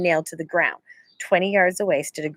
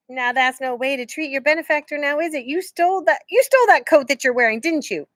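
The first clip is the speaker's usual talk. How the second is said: teasing outlaw; 8 semitones higher than usual. A narrator's reading. Outlaw scolding boy.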